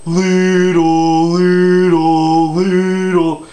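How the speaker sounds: loud, high, drawn-out cartoon false voice; Patrick Star. Shown as a demonstration. Coach demonstrating Patrick's leedle for false chords, Patrick Star impression. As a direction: belt out each silly word long and high. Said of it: "also do Patrick when he does".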